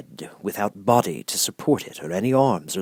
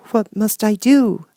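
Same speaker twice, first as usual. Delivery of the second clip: put-on much higher small girl's voice; a meek little girl finding courage; little Dorothy; 14 semitones higher, 8 dB louder, slower than usual. Dorothy asks.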